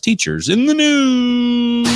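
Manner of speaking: slow, high, drawn-out show announcer. Acted. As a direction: belt out the segment title like a host. Announces his podcast segment title.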